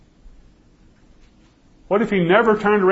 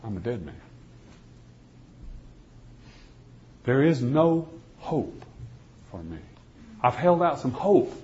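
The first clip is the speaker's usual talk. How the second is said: much softer, defeated and hushed; hopeless despair; 5 dB quieter than usual. Retold or imitated. A prisoner alone in his cell, softly giving up all hope. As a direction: let the hope drain out of every word. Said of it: "and I say".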